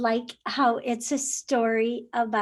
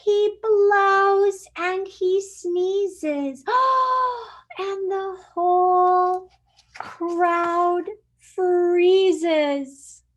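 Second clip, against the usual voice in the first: louder, slow dramatic reading; dramatic suspense; 4 semitones higher, 6 dB louder, much slower than usual. Acted. Teacher building tension for the kids, slow and loud. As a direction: build the drama as the crowd freezes.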